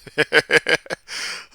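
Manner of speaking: loud, high burst of laughter; real amused cackle; laughing. Real, not acted. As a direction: crack up laughing. Laughs at slowed clip.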